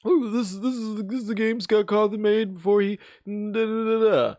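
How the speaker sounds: high, gushing hype streamer; excited streamer voice. Pretend. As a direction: mimic a thrilled streamer showing off.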